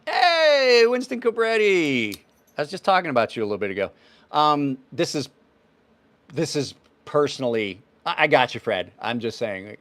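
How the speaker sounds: usual speaking voice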